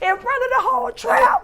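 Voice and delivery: excited shout. Real, not acted. Reliving the shout.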